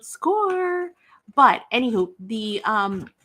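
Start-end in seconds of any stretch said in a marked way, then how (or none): 0.0-0.9 high, drawn-out note of victory; gleeful sing-out; triumphant cheer. Real, not acted. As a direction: celebrate the bargain, stretching the word. Celebrating the bargain.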